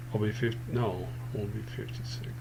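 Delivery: ordinary talk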